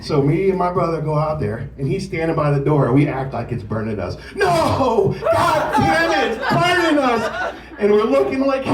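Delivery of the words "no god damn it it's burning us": fake agony. Brothers faking acid-rain agony to scare little brother. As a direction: ham up burning in acid rain.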